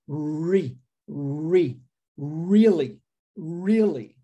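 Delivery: really with the R curled back, tongue folded. Shown as a demonstration. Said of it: "pegue esse R e, e dê uma dobrada na língua".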